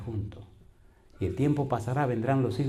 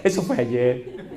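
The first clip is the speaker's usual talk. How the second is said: laughing; 3 dB louder, much slower than usual. Retold or imitated. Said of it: "Como quien dice".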